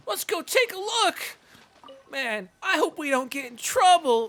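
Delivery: high, bright cartoon sidekick; squeaky excited companion voice; high Paimon voice. Voicing Paimon's dialogue, pitched up.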